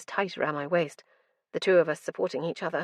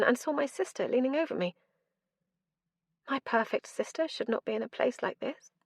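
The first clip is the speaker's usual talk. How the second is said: ordinary delivery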